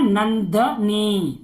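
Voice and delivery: "नंदनी" drawn out slowly, the न after नंद clearly heard; slow sounding out. Shown as a demonstration. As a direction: stretch "नंदनी" so each syllable sounds. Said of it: "नंद आ रहा है". ("नंदनी" धीरे-धीरे, हर अक्षर साफ़)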